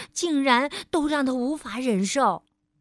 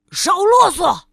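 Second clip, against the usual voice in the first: high loud shout; angry yell; 7 semitones higher, 11 dB louder than usual. Acted. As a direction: cut him off with a yell. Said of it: "暴躁先生叫喊着".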